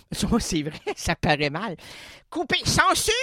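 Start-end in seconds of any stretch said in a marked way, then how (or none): 2.3-3.1 mock shout. Calling out for censorship.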